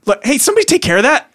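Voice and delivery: quick, high-pitched order; gnome calling staff. Acted. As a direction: call out hurriedly for a clean-up. Gnome calls for a clean-up.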